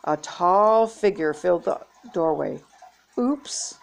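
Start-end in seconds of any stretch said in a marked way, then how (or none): 3.2-3.8 high, light little oops; playful, higher-pitched; giggly. A narrator's reading. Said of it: "Becky said with a giggle".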